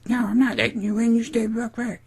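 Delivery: high falsetto standoff voice; mock defiant woman. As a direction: imitate her refusing, way up high. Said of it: "And she's like".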